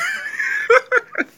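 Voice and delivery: high-pitched burst of laughter; helpless high laugh. Real, not acted. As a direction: let a loud squeal of a laugh out. Laughing at the show.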